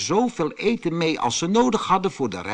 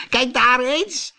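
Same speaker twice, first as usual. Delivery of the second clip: troll voice; 12 semitones higher, 4 dB louder, slower than usual. A narrator's reading. A troll points out the bear.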